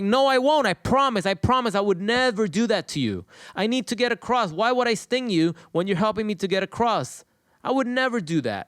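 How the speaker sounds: high-pitched, insistent promising; pleading scorpion. Acted. Scorpion begging the frog for a ride across, insistent pleading.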